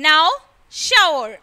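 now, shower with the "ow" glide of how. Shown as a demonstration. Teacher finishing the how list, OW demo. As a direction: model now and shower as separate words, both with "ow". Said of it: "pronounced as in how".

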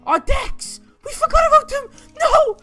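ordinary talk

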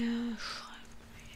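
soft whispered aside; whispering. Real, not acted.

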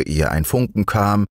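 plain reading voice

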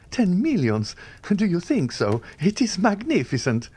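greedy youth hearing of ten millions, amazed; dazzled amazement. A narrator's reading. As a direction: gasp at the fortune. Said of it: "quite confounded at the metallic sound".

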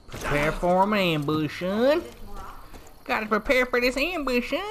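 hammed-up rural Oakdale voice, calling everyone son; Oakdale hillbilly talk. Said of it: "That's how people in Oakdale talk".

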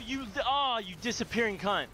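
usual speaking voice

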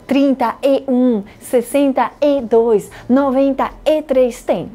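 "Trinta e um" said slowly, with the "e" marked as "y"; slow model. Shown as a demonstration. Teacher answers a student about the conjunction, slowly. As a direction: stress the "e" linking the number words. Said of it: "como em português?".

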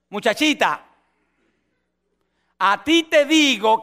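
put-on slow, rising command to the girl; commanding Jesus. Jesus commands the dead girl to rise, slow and firm. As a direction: call her with authority. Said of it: "está allí y dice".

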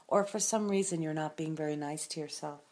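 ordinary talk, nothing marked

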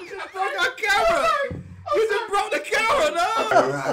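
high, shouted laughing complaint; exasperated yell. Real, not acted. Cameraman yelling at friend who broke camera. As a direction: yell it half laughing, half upset.

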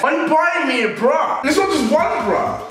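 indignant shout at the gift; outraged shout. Real, not acted. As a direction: yell it in mock outrage.

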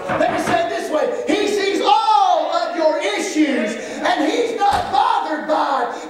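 high, loud, slow preacher's cry, voicing God's comfort; full-force preaching. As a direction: lift the congregation with a ringing promise.

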